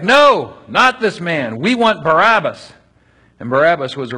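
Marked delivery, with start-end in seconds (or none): 0.0-2.7 loud, high shouted refusal; hammed-up mob yell; crowd shouting back. A narrator's reading. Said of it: "They shouted back".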